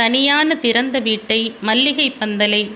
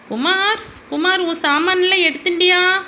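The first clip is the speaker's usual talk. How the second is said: calling out; 8 semitones higher than usual. Acted. Mother calls her son.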